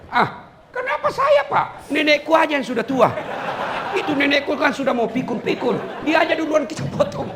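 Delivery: hammed-up squeaky schoolboy protest; whiny teen boy. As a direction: squeal like a cheeky schoolboy dodging blame. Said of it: "anaknya bapak yang S M P".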